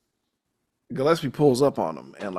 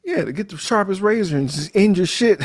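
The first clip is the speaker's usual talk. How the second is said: higher, punchy joke delivery; higher mock Harvey; 8 semitones higher, 4 dB louder than usual. Harvey giving Tibbs a savage joke answer, higher and punchy. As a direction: pitch it up and deliver the punchline with glee. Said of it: "He said".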